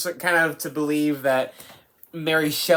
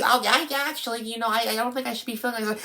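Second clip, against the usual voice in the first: high, softer mock character voice; Ida; 7 semitones higher, 3 dB quieter, faster than usual. Reviewer showing the actress switching back mid-rant, high soft impression. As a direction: imitate her flipping back, pitched up and quieter. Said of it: "she goes back and forth".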